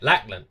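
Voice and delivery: "Lackland" ending on N, no final D; dropped D. Shown as a demonstration. Admitting he says it too, dropped D in "Lackland". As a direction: say "Lackland" as Lack-lun. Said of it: "Even I say it".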